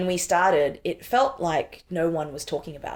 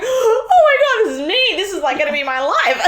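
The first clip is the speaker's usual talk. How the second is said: high, loud, laughing disbelief; shocked laughing realisation; 9 dB louder than usual. Retold or imitated. Reading the paper about herself, laughing shock. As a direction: squeal it out as it hits home. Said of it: "shocked and fearful".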